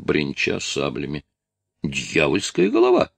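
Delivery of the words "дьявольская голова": usual speaking voice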